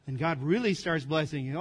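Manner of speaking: ordinary delivery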